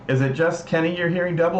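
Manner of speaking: usual speaking voice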